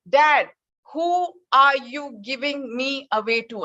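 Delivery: son's third, slow, pleading demand; insistent plea. Retold or imitated. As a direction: stretch it out, last try for an answer. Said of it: "Once again".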